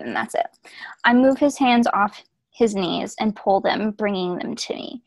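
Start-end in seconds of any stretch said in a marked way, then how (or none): 0.0-0.4 hushed unvoiced whisper. Real, not acted. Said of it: "whispered aside".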